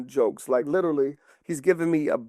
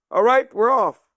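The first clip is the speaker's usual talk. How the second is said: louder, higher command to march; rallying call; 7 semitones higher, 6 dB louder, slower than usual. Acted. King Arthur to his army, loud rallying call. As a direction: call out to the troops.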